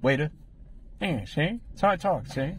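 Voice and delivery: put-on old-time tough guy; clipped wise-guy patter, see; 1930s gangster voice. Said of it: "talking like a nineteen thirties gangster".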